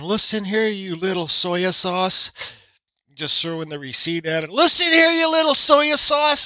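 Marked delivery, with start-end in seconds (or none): 0.0-2.7 imagined put-down. Retold or imitated. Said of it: "I felt like saying". 4.5-6.4 much higher, louder, drawn-out mock yell; imagined yell. Imagined telling off the cashier, high mock yell. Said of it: "I felt like saying".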